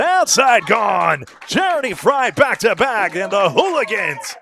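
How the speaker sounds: loud, high sportscaster shout; rousing bowling commentary; excited play-by-play. Real, not acted. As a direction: belt out the big call, easing off at the end. Live play-by-play call.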